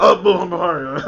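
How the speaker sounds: mock sneeze hiding a name, through laughter; fake sneeze. Host imitating co-host passing a name off as a fake sneeze. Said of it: "I was just sneezing. I was just coughing".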